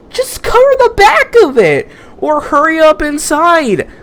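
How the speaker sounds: put-on very high falsetto girl, bossy and excited; hammed-up schoolgirl urging her to hide; high girly falsetto.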